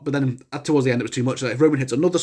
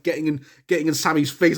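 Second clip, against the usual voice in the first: ordinary talk, nothing marked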